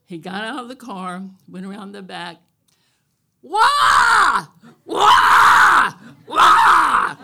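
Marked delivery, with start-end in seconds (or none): 3.4-7.2 loud high bellowing cattle call. Retold or imitated. Rancher bellows to call his cows. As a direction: holler it across the pasture. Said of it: "my cattle calling voice".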